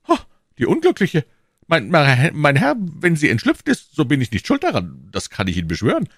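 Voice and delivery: high, loud, stammering protest; agitated prisoner. Acted. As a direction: swear innocence in alarm. Voices Bonacieux protesting innocence.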